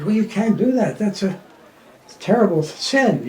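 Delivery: hammed-up priest telling off the bearer; mock scolding priest. Priest scolds the bearer for dropping the coffin, mock indignant.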